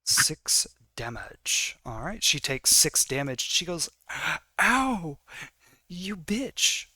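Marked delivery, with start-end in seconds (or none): 4.6-6.9 higher mock cry of pain; acted "ow" from taking a hit; pained outburst. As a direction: play a hurt, indignant yelp. Acted cry of an injured character.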